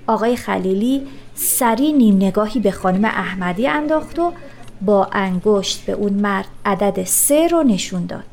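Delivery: ordinary delivery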